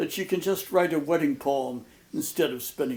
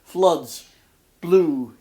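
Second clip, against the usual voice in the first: floods and blue hit louder, each word set apart; stressed cluster words; 8 dB louder than usual. Shown as a demonstration. Lecturer stressing the L clusters for the class, punched. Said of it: "initial consonant clusters with L".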